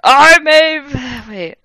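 loud, high put-on Hagrid voice; hammed-up Hagrid. Hagrid declaring himself, loud and hammed-up. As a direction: boom it like a silly giant.

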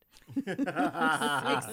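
burst of laughter. Real, not acted. She laughs at her daughter's phrase.

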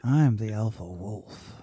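low, very slow self-styled alpha; mock-dominant, drawn-out low growl; alpha male act. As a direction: play the alpha, low and unhurried. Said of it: "[name] playing alpha".

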